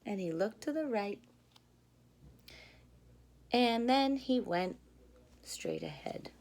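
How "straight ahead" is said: usual speaking voice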